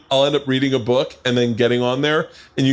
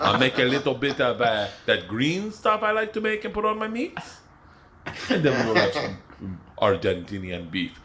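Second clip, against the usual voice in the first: put-on Argentinian accent; 5 dB quieter than usual. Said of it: "I'll do a full sentence".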